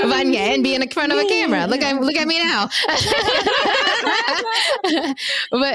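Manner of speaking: high, giggly laughing talk. Real, not acted. Sister joking about her acting dream, laughing. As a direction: laugh through the words at yourself.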